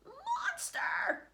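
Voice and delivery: sudden high shriek of alarm; cried out. Acted. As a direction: scream the word at the snake. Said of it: "she cried".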